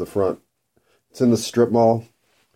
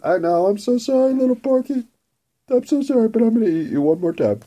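high, sing-song fake sorry; mock apology to pig; 11 semitones higher than usual.

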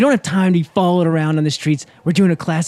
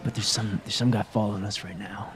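hushed warning; 8 semitones lower, 10 dB quieter than usual. Acted. Whispering about a tail.

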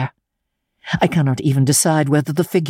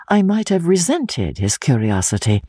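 plain reading voice

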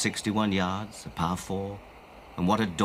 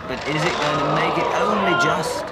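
excited; 7 semitones higher, 7 dB louder than usual. Real, not acted. Tense finish of a putt.

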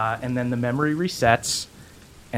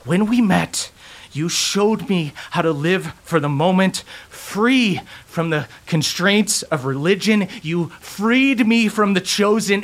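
desperate plea; 9 semitones higher than usual. Acted. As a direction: voice climbing high in desperation. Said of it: "Lydia grabs him desperately".

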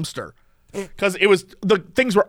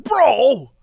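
higher, exasperated imitation of an angry driver; mad driver; 6 semitones higher, slower than usual. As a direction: complain like an aggrieved bro, pitched up. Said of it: "he was, like, so mad".

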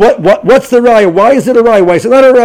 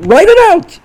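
higher, louder, pushing urgently; urgent command; 12 semitones higher, 4 dB louder, slower than usual. Real, not acted. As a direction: spur them on to write it. Urging students to write Gemara.

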